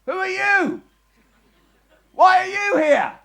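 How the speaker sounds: high, loud question thrown back up; rabbi echoing the question. Acted. Akiva calling the guard's question back up to him. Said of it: "So he looks up ... he says".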